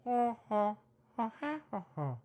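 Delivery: high ha ha hoots; the monkey answering back; monkey response. Pretend. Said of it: "And it responds".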